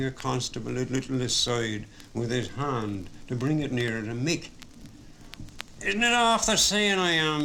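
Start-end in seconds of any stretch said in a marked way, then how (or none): 5.8-7.4 bartender insisting, words pressed hard; emphatic. A narrator's reading. Bartender warning the pub, said emphatically. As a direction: lean in and lay it down firmly. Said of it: "he said emphatically".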